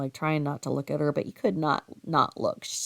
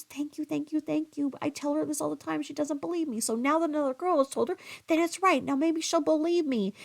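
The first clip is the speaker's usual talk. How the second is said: higher, breathless gushing thanks; grateful gushing; 10 semitones higher than usual. Retold or imitated. Boyfriend thanking the narrator for complimenting his girlfriend, gushing. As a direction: gush your thanks like a relieved boyfriend.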